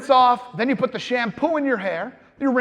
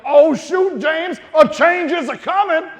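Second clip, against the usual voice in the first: higher and louder hammed-up announcement; mock rallying cry to himself in the shower; mock eureka; 4 semitones higher, 5 dB louder than usual. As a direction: proclaim it to yourself like a prophecy. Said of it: "and I was like".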